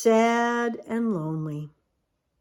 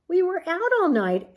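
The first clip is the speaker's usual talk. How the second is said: bat voice; 8 semitones higher than usual. Acted. Bat keeps talking.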